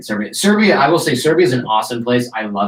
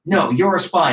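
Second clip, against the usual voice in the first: mock aggressive militia, pitched higher; retold objection in a raised pitch; aggressive challenge; 5 semitones higher than usual. Militia guys doubting his cover story, mildly aggressive. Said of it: "They're just being aggressive".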